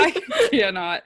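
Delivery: words squeezed out mid-laugh; laughing too hard to talk. Real, not acted. Still laughing at the Draco joke.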